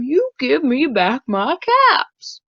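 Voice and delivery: a mild scold at the monkeys; scolding peddler. Acted. Peddler scolds the monkeys in the tree, mildly cross. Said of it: "he said, shaking a finger".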